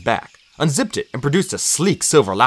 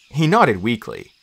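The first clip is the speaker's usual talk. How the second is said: ordinary delivery, nothing marked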